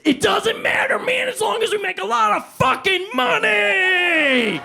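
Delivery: high, loud, slow yelling; over-the-top shout. Acted. Actor going maximum big for the director, shouting. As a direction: blow it all the way up, shouting. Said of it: "let's go bigger, bigger".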